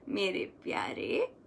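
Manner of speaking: right way: soft and loving; tender. Shown as a demonstration. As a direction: coax it gently like a dear child. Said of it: "ऐसे बोल रहे हैं कि".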